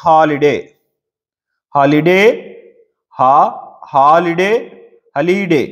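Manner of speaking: Holiday with the first syllable హా pressed harder; stress on HA. Shown as a demonstration. Teacher showing students where the stress falls, pressing the first syllable. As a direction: lean on హా in holiday, the rest lighter. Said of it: "ఎక్కడ ఒత్తి పలుకుతున్నట్టు అనిపిస్తుంది?".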